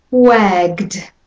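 Wagged, G straight into D, one syllable; wagged with a final d. Shown as a demonstration. Teacher models wagged for learners. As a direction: close the G right into D in Wagged. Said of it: "goes from the G to the D sound".

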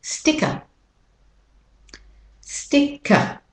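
high British-Australian "sticker" ending in "uh"; British-Australian accent. Shown as a demonstration. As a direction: drop the R at the end of "sticker", British-Australian style. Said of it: "British, Australian R".

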